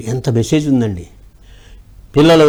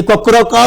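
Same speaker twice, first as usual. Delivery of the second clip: higher cock-a-doodle crow; rooster crow; 8 semitones higher than usual. A narrator's reading. Hen crows back at the cat. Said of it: "అని అరిచింది".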